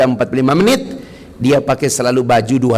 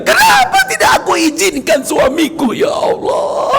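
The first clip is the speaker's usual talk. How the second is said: regretful cry. Retold or imitated. Wife wailing to God over her husband. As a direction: wail it out in regret. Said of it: "Ibu-ibu akan teriak".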